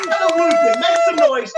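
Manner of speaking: high, loud MC shout; hyping the crowd. Real, not acted. Host urging audience to make noise, hyped shouting. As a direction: whip the room into a frenzy.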